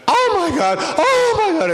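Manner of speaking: put-on high, loud, gushing Dutch-accented cry; overexcited Dutchwoman, loud and high; heavy Dutch accent. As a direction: exclaim it in a thick Dutch accent. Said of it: "in this heavy, thick, uh, Dutch accent".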